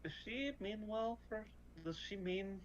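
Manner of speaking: soft, secretive questioning; hushed whispering. Acted. Player asking a bird whether Crow Mother means well, hushed. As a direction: ask it softly, as if it were a secret. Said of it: "She's, like, whispering now".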